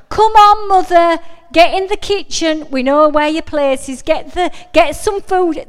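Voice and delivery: loud, high mock bossing of mum; teasing sons. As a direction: shout it like cheeky sons ordering mum to the kitchen. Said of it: "They do this winding".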